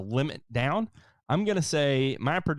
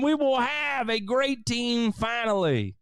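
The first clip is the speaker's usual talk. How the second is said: mock hype; 14 semitones higher than usual. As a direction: sell next season like a hammed-up promo. Hyping team's next season.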